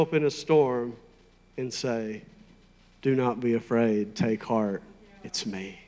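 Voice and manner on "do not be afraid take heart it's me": low, quiet, calm reassurance; Jesus. Acted. Jesus speaking to disciples in a storm, calm. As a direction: drop low and settle their fear softly. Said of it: "nobody talks like Jesus talks".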